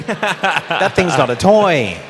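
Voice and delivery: loud, high, drawn-out admonishment; mock warning. Storyteller warning the audience about the ATV, loud mock admonishment. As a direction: belt out the comic moral, stretching "toy". Comic moral after the story.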